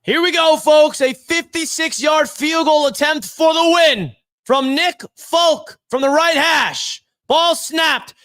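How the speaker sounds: excited, high, shouting play-by-play call; live play-by-play. Acted. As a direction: call the winning kick like a live broadcaster, yelling. Live call of winning kick.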